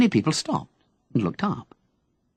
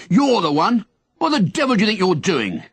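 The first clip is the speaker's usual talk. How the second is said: angry bellow; 6 semitones higher than usual. Acted. Policeman confronting Henry at his door, angry bellow. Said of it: "he bellowed angrily".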